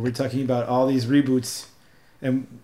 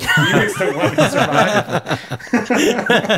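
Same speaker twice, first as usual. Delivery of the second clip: laughing; 7 semitones higher, 4 dB louder, much slower than usual. Real, not acted. Punchline of meme story.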